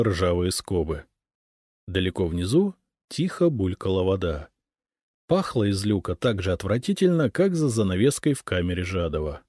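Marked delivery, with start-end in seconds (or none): none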